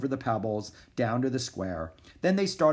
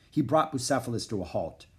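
plain reading voice

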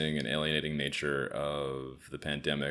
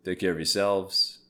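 vlog sign-off; 6 semitones higher, 10 dB louder than usual. Real, not acted. Vlog outro.

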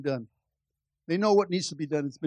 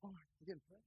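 hushed, much softer humble murmur; Marcos, humbly; 19 dB quieter than usual. Retold or imitated. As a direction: barely voice it, shy and humble. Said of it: "the humility of Marcos when he prayed".

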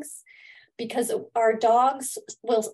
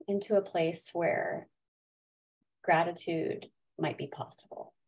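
guided meditation; 5 dB quieter than usual. Acted. Leads a gratitude meditation.

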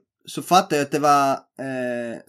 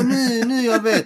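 much higher, gleeful recognition; imitating excited cop; 10 semitones higher, slower than usual. Mimicking the cop thrilled to know HIF, much higher. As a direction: squeal it like a delighted fan.

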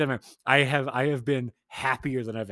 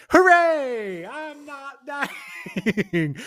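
very high, drawn-out mock cheer breaking into laughter; hammed-up celebration; 14 semitones higher, 6 dB louder, much slower than usual. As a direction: cheer loudly in a silly high voice. Mock celebration of not dying.

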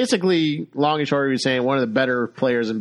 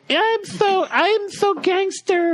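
much higher and very slow, mocking; mock gangster girl; much slower than usual. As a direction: drawl it as a fake tough girl. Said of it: "It's like".

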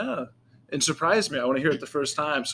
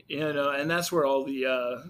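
ordinary delivery

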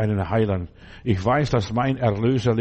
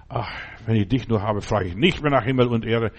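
ordinary delivery

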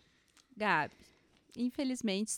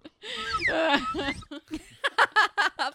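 long burst of laughter; loud high laugh; 10 semitones higher, 6 dB louder than usual. Real, not acted. Laughs at the nerd joke.

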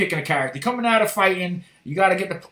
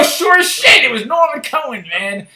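usual speaking voice

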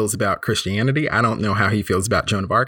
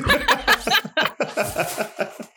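laugh. Real, not acted. Laughing at friends' opinion.

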